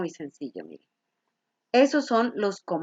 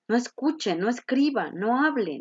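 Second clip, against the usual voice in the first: ordinary delivery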